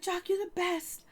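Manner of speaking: mock crying. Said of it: "she's crying over here".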